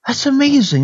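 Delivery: imitating delight, pitched well up; retold amazement. Preacher retelling lady's reply to encouragement, mock amazed.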